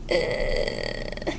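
low unvoiced startled uh; startled grunt. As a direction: catch your breath in surprise. Anthony sees the judge.

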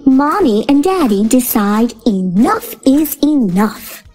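usual speaking voice

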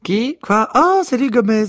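put-on very high, startled old woman's voice; high, bewildered old-lady voice; feeble old lady. A narrator's reading. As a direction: pipe up like a confused octogenarian. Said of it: "dit faiblement Miss Trixie".

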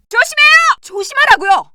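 high, loud alarm; warning shout. Acted. Citizen yelling at the doctor to watch out, loud. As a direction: yell the warning.

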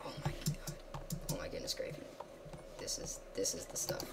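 hushed, breathy awe; soft awestruck whisper; awed whisper. Real, not acted. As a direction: whisper in wonder.

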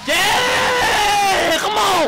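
long high celebratory yell; jubilant shout. Real, not acted. Fan celebrating LeBron's three, high jubilant shout. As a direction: roar in triumph, pitched high.

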